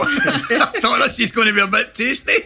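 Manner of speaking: high, laughing through the words. Real, not acted. Recalling the old teammate blanking him in the tunnel, laughing. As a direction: chuckle it out, delighted.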